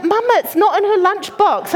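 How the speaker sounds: put-on high-pitched child voice; high, young call to mother; child reporting back. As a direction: imitate a puzzled young girl.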